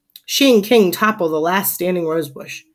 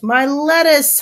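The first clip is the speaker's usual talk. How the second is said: high, raised wail; hollered lament; 7 semitones higher, 4 dB louder, slower than usual. A narrator's reading. Neighbor sees garden crushed, hollered lament. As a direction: cry out over the damage. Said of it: "hollers the next door neighbor".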